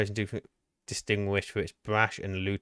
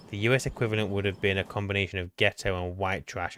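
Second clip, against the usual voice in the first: normal talking voice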